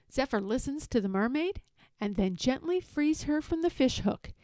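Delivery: plain reading voice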